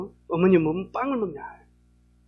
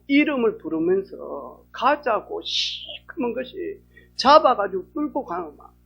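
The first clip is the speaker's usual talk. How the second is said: ordinary talk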